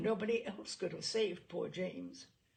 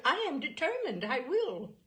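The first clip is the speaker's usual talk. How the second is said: eager, bright insistence, much higher and louder; determined girl; 7 semitones higher, 6 dB louder than usual. A narrator's reading. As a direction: insist on it gleefully, pitched high. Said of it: "She smiled and said".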